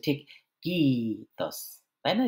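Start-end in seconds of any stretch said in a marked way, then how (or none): 0.6-1.6 "কিতস" with a long, strong "কি" and dropped "তস"; first-syllable stress. Shown as a demonstration. Teacher showing where the voice drops, first syllable stressed in "কিতস". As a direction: lean on "কি", let "তস" fall away. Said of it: "প্রথমটা একটু বেশি শব্দ হলো".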